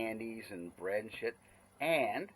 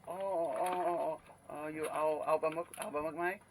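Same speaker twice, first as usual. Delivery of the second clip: higher, sing-song market seller voice; friendly seller voice, higher pitched; imitating the Thai seller; 5 semitones higher than usual. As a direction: lightly mimic a Thai vendor greeting a regular. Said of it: "she looks at me and she says".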